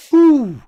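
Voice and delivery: high, loud "whew"; excited whew. Real, not acted. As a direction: let out a high, loud whoop of amazement. Excited reaction to stock chart.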